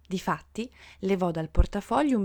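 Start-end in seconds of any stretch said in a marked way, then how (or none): none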